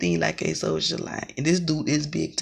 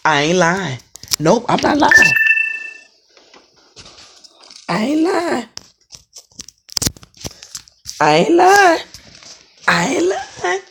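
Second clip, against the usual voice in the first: insistent; 11 semitones higher, 7 dB louder than usual. Real, not acted. Insisting to viewers.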